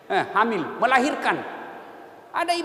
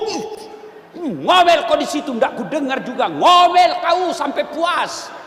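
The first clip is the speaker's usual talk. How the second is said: mock shout down the well; 3 semitones higher, 4 dB louder than usual. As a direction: yell down the well at the nagging wife.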